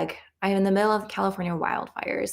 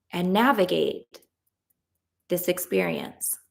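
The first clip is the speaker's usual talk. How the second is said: tapping script. Acted. Coach leading a tapping round, calm guided voice. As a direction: lead the group through the affirmations calmly.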